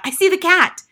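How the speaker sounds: high, loud alarmed cry; cried out. Acted. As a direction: shout the warning in sudden fright. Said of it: "suddenly cried out".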